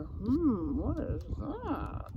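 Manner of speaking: plain reading voice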